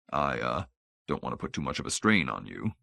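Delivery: low, soft, halting reassurance; tentative, subdued and careful; hesitant concern. A narrator's reading. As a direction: back off gently, voice dropped low.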